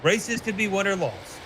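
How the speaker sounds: ordinary talk, nothing marked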